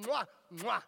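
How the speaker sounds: big loud smacking kiss noises; imitated kisses. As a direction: plant two exaggerated mwahs.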